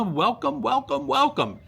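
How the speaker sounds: high lilting welcome; sing-song welcome. Real, not acted. Host welcoming viewers, sing-song welcome.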